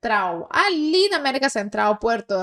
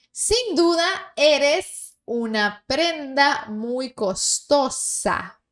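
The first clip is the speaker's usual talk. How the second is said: slow dictation; 3 semitones higher, much slower than usual. Shown as a demonstration. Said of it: "reading sentence for repetition".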